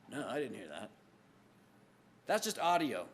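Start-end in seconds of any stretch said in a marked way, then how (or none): 0.1-0.9 lower answering voice. Acted. Preacher voicing a listener who heard nothing, lower and softer. Acted reply in another voice.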